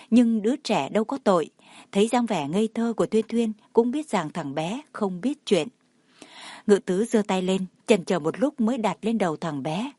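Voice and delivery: plain reading voice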